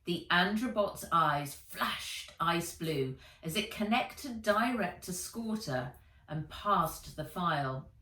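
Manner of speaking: usual speaking voice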